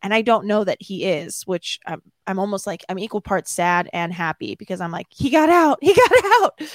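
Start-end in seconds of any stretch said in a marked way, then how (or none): 5.1-6.6 high, loud celebration; elated cheer. Retold or imitated. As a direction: cry it out like news of an escape. Acted joy at his escape.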